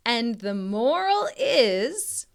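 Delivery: plain reading voice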